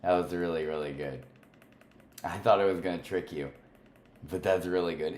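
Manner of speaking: ordinary talk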